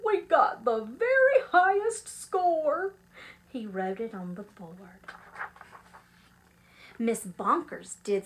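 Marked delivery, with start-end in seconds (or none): 0.0-3.0 high, loud, triumphant cry; exultant roar of good news; jubilant announcement. Acted. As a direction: proclaim it with delight. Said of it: "he jubilantly roared".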